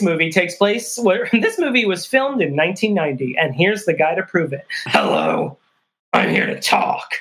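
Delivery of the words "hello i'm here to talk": very deep, odd put-on voice; a deep growl that fades into near whisper; imitating the actor with a hammed-up low voice; 1 mock character voice. Voicing a movie character.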